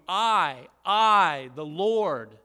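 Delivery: "I" twice, each loud and held, then "the Lord"; stress on I. Shown as a demonstration. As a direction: hammer each "I" as its own emphatic beat. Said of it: "it's emphasizing who is gonna be doing the action".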